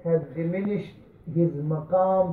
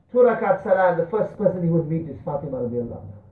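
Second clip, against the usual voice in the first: normal talking voice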